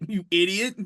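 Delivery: normal talking voice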